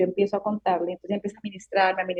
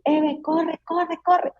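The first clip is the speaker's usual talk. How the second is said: urgent call, much higher, in a voice unlike her own; voice of Jesus; 9 semitones higher, much slower than usual. Retold or imitated. Woman retelling how Jesus called her to run, urgent and high. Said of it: "escucho la voz así, literalmente Jesús que me dice".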